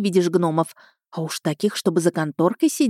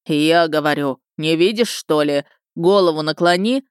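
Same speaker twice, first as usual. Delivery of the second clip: grumbling thunderous gnome. A narrator's reading. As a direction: boom at her like a cranky gnome. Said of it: "недовольное грохотанье".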